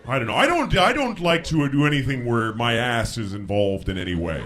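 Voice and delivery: ordinary talk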